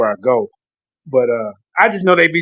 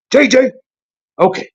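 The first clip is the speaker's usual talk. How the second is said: higher, put-on imitation of another man's accent; Garfield's accent; 6 semitones higher than usual. Garfield telling his friend to invite people, imitated accent. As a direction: mimic his accent as he gives orders. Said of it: "You better stop with that accent".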